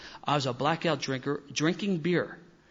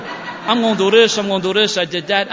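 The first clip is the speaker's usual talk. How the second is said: put-on loud drunken boast; 5 semitones higher, 11 dB louder than usual. Drunk fan boasting to his friends, loud and cocky. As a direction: shout it like a drunk bragging he'll do it.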